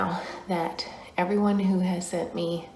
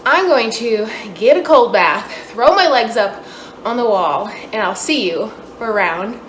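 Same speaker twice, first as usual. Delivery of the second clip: excited; 3 semitones higher, 8 dB louder than usual. Real, not acted. End of hard run, loud push.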